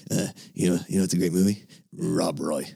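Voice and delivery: imitating the old teacher. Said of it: "He was this funny old man".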